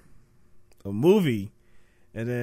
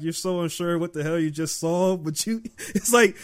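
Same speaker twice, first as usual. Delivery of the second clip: normal talking voice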